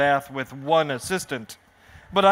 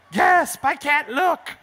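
much higher, hammed-up fright; mock gasp; 7 semitones higher, slower than usual. Spectator too scared to watch the stunt, hammed-up fright. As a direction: peek through your fingers in mock fear.

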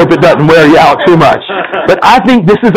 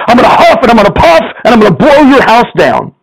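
higher mock threat; a hint of the bragging pig; big bad pig; 8 semitones higher than usual. Pig threatens flower house.

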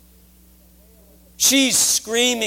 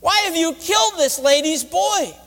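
high, accusing cry to God; raw, indignant protest; harsh words; 10 semitones higher than usual. Acted. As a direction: demand it of God, pitched well up. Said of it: "Harsh words".

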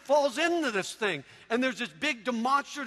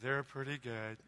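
very low, soft and unexcited; mock-glum murmur with no enthusiasm; deadpan Eeyore-like mom; 11 semitones lower, 12 dB quieter than usual. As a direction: imitate an underwhelmed, gloomy mom. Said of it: "she's just being herself".

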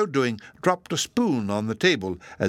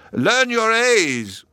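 much higher, louder, slow call to the crowd; shouted; 12 semitones higher, 6 dB louder, much slower than usual. A narrator's reading. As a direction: bellow it to the whole hall. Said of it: "he shouted".

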